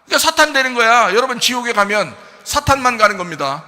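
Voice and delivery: rising, loud sermon delivery; fervent preaching. Acted. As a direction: drive the point home to the congregation. Preaching at full force. (열정적인 설교)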